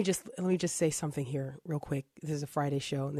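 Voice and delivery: hushed, quiet voice; whispering. Real, not acted. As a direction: drop to a hush like a secret. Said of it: "I don't know why I have to whisper this".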